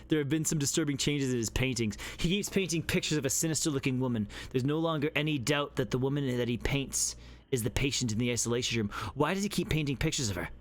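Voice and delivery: low, even reading of a report; game text read. Acted. Reading a game note to chat, low and grave. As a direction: read it like a grim case note. Reads game document aloud.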